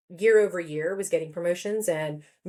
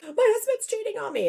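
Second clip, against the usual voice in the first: much higher mock tearful voice; imitated crying. Friend in fancy car confides in passenger, mock crying. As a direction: put on a high, upset wail. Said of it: "then they start crying 'cause they're like".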